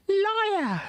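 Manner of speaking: high-pitched accusing yell as Gavroche; shouted liar. Retold or imitated. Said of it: "Gavroche is like".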